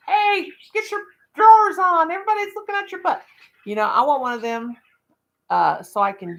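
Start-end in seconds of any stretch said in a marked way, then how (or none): 0.1-3.2 high, loud pretend yell; bullhorn yell. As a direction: holler it through a bullhorn. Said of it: "you yell through 'em".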